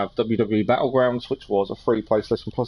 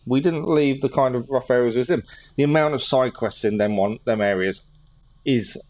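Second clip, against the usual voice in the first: ordinary talk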